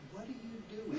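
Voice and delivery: "doing" said as doin', without the g; Texan dropped g. Shown as a demonstration. Teacher illustrating Texan ing words, "doing" as doin'. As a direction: let "doing" end on a soft n. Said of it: "we tend to say that".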